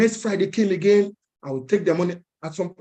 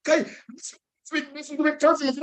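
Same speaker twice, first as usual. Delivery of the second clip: mocking beggars; 9 semitones higher than usual.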